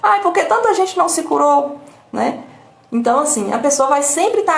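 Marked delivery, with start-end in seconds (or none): 0.0-1.8 higher, whiny reply; hammed-up plaintive answer; mock victim's excuse. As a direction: parody the victim justifying their tears. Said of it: "o filho curou da covid".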